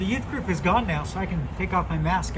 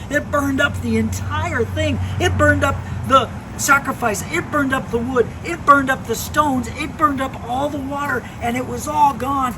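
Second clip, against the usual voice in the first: excited climax; 10 semitones higher, 9 dB louder than usual. Acted. Pastor telling kids the fire fell, excited and louder. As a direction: land the miracle with wonder.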